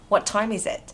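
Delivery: words run together at natural speed; quick linked reading. Shown as a demonstration. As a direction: say it fast and connected. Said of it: "đọc nhanh ha".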